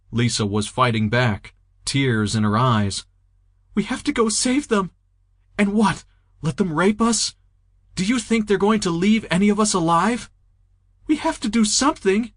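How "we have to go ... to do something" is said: high, urgent, anguished pleading; anguished plea. Acted. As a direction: beg her to go back and save them. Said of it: "with pure anguish in her eyes".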